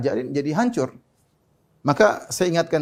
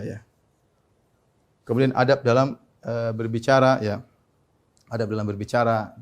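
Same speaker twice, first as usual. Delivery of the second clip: ordinary delivery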